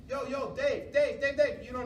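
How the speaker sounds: calling out loud. Retold or imitated. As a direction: shout Dave's name across the field. Calling to another coach.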